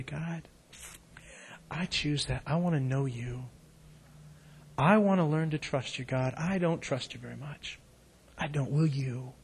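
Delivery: hushed, intimate prayer; quiet prayer. Shown as a demonstration. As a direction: pray it softly to God. Modelled prayer for listeners.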